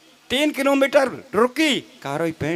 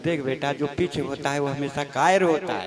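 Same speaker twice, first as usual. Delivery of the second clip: put-on old father; 10 semitones lower than usual. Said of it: "बोल".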